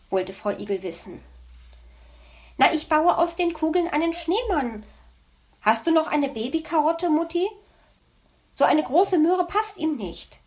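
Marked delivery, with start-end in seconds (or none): 2.6-10.4 put-on higher, eager little boy; child voice. Hedgehog child tells mother about snowman, excited and high. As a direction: bubble over like an excited small kid.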